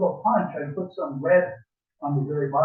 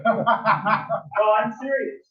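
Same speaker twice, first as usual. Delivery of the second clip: laughing; 6 semitones higher, 5 dB louder, much slower than usual. Real, not acted. Laughing while insisting on answer.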